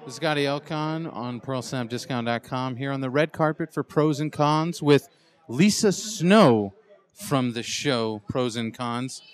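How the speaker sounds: drawn-out announcer intro on the red carpet; gushing red carpet chatter. Acted. Host announces guest on red carpet.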